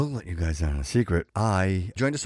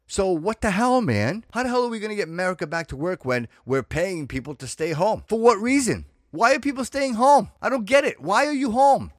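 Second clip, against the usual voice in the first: louder, much higher, heated shouting; fired-up rant; 11 semitones higher, 6 dB louder than usual. Real, not acted. Podcaster ranting to listeners about people staying home, shouting. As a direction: let the frustration boil over into a yell. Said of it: "Got me all fired up".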